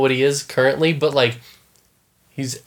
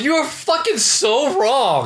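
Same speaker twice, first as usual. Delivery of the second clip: high, drawn-out outraged retort; mock-indignant and high-pitched; emphatic rebuttal; 10 semitones higher, slower than usual. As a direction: shoot it down, pitched way up by the end. Acted retort to imagined dissenter.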